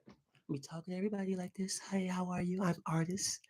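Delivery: soft whispered greeting; mock whisper. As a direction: whisper it as if saving your voice. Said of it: "I think Imma do that".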